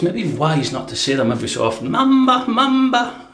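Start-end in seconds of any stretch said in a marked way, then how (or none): 1.9-3.3 much higher sing-song Mamba, Mamba. Acted. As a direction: croon Mamba up high. Repeats sing-song name.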